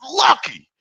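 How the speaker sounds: high mocking taunt; taunting squeal. Taunting panel guests, high mocking squeal.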